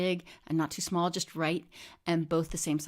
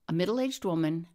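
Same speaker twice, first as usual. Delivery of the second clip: ordinary delivery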